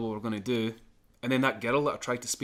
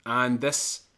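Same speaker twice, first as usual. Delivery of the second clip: usual speaking voice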